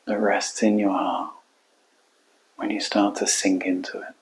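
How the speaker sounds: calm meditation guide, long unhurried pauses; guided meditation. Acted. Coach guiding listeners into their hearts, calm guided meditation. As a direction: guide them inward, leaving space between phrases.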